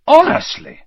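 high, loud burst of exasperation; exasperated scoff. Acted. Ron scoffs at Hermione's panic, exasperated. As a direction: exclaim it as if you can't believe her.

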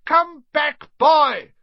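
high, very loud shout; urgent teacher's shout. A narrator's reading. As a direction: yell after someone flying away. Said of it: "she shouted".

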